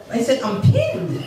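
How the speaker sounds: ordinary delivery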